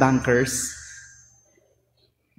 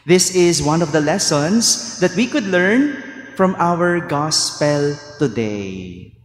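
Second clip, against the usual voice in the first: usual speaking voice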